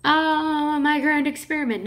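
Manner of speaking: a hint of a pompous father; proud scientist father. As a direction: gush over the experiment, not the daughter. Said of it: "her father's like".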